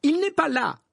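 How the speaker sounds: high, loud angry retort. A narrator's reading. Woman answers the imperious minister, angrily. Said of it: "répondit celle-ci avec colère".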